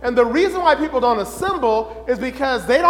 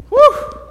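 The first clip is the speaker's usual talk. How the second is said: high, loud exhale of relief; relieved whew; 13 semitones higher, 7 dB louder than usual. Acted. Arriving at midweek church after the battlefield, loud high relieved whew. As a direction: blow out a big relieved whew.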